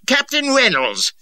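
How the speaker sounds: high, loud furious bellow; bawling. A narrator's reading. An angry colonel bawls at a captain over dirty sheepskins. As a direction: roar it like an enraged lord. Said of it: "he bawled".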